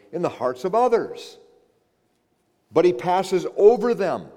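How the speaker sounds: loud, high-pitched sermon delivery; impassioned preaching. Real, not acted. Preacher proclaims to congregation that God chose to intervene, impassioned preaching. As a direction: preach it forcefully, driving each phrase home.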